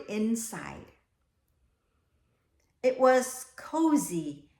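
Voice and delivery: slow, cozy read-aloud; storybook reading. A narrator's reading. Teacher reading the class a picture book, slow and cozy. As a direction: savour each phrase for the children.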